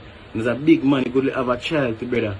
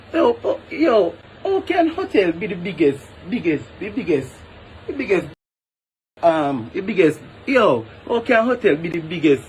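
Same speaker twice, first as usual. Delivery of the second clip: incredulous outburst; 4 semitones higher than usual. Real, not acted. Ranting to camera about hotel wages, incredulous and sputtering. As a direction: pitch jumps higher, stammering in disbelief.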